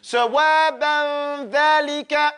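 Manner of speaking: sawaban dhalika drawn out slowly; slow teaching demo. Shown as a demonstration. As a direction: model it slowly for the class. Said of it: "So now we have it".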